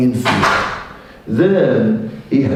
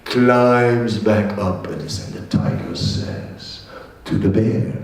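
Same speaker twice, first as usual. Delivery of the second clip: normal talking voice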